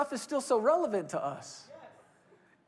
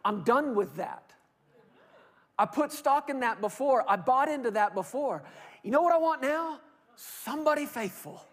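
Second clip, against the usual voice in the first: fed up; 5 semitones higher, 4 dB louder than usual. Retold or imitated. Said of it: "coming from this point of view".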